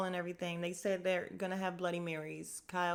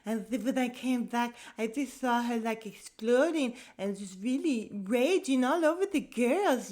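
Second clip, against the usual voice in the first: higher, louder excited gossip voice; mimicking Diana; 5 semitones higher, 4 dB louder than usual. Vlogger imitating Diana recounting the fight, high and loud. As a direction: tell it like breathless juicy gossip. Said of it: "she's just like".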